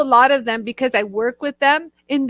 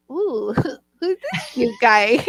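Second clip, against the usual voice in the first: giggly flirty; slower than usual. Retold or imitated. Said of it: "and I was like".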